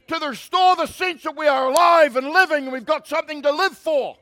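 high, loud preacher in full cry; impassioned preaching. Real, not acted. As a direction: build repeated phrases into ringing shouts.

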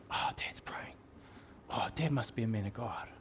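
awed little whisper; hushed kid whisper. Pretend. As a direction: breathe it like a kid spying through the door. Kid overhears dad praying.

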